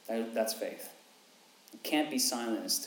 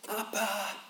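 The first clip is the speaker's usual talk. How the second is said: high mock child's cry for Dad; 9 semitones higher than usual. Pastor imitating his child calling at night, high cry. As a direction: call out like a scared kid at night. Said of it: "They would cry out".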